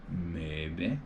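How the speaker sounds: drawn-out, noncommittal MAYBE with a rising end; coy maybe. Real, not acted. Streamer dodging a viewer's question about racing in Texas, coy. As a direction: stretch MAYBE and lift the end teasingly. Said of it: "Why you gotta say it like that".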